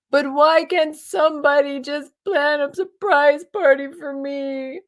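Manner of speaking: high, loud pleading whine; whiny self. Retold or imitated. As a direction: sulk and whine for a surprise party. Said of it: "and I'm whiny".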